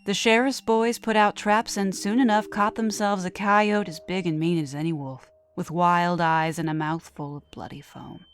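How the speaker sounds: plain reading voice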